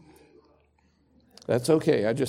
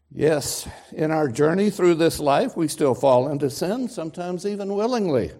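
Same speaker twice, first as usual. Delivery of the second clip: louder, driving pulpit delivery; forceful preaching; 4 semitones higher, 6 dB louder than usual. Acted. Preacher to congregation, forceful sermon delivery. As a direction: press the point home from the pulpit.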